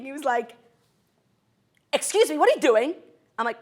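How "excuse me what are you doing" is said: loud, high mock scolding; yelling customs agent. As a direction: imitate an indignant official telling someone off. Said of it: "he was yelling at me".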